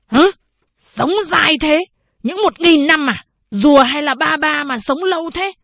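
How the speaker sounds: loud, high incredulous scoff; mocking disbelief. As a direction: scoff at the thousand years, loud. Old man mocks clerk's thousand years. (kinh ngạc chế giễu, to giọng)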